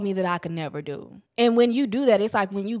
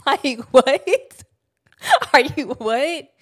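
much higher, louder laughing what; laughing disbelief; 6 semitones higher, 7 dB louder, much slower than usual. Real, not acted. Reacting to mom's relief.

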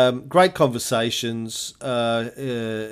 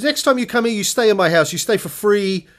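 higher, louder Czech host, generous; Czech-accented invitation, higher; 8 semitones higher, 4 dB louder than usual. Retold or imitated. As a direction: insist like a Czech host offering his house. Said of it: "he had an accent".

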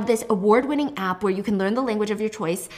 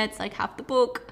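normal talking voice

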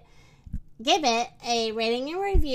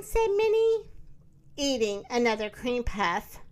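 plain reading voice

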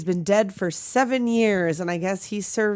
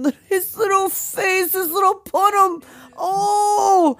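much higher, louder, near-crying coo; 12 semitones higher, 4 dB louder than usual. Real, not acted. Cooing over the dressed-up kid.

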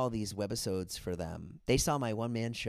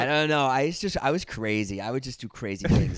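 loud defensive; 5 semitones higher, 9 dB louder than usual. Real, not acted. Defensive laughing reply.

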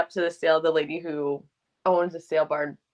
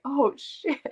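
higher, laughing curse; dismayed realization; 9 semitones higher than usual. Retold or imitated. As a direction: laugh it out as it dawns on you. Brandon realizing the crowd coming.

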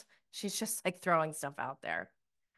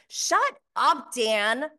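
higher, louder exasperated shut up; annoyed; 5 semitones higher, 6 dB louder, slower than usual. Real, not acted. Host annoyed at Dan's comment, real exasperated outburst. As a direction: snap at him in real irritation.

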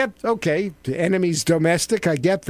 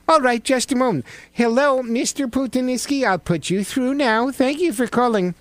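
high, sing-song phone operator; connecting the caller to the president, high and polite; 6 semitones higher than usual. Pretend. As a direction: put the caller through, pitched way up.

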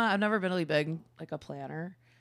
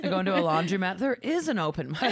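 ordinary talk

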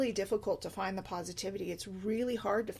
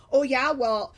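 loud, defensive comeback, a bit higher; combative retort; 4 semitones higher, 9 dB louder, slower than usual. Retold or imitated. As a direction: snap back like you're picking a fight. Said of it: "dive in and I'm like".

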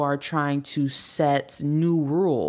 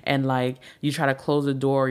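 ordinary delivery

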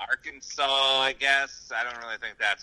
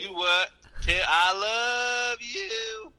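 slow, drawn-out, higher Southern twang; 10 semitones higher, much slower than usual. Pretend. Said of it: "this is so much worse".

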